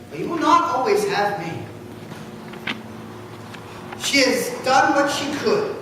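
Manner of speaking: ordinary talk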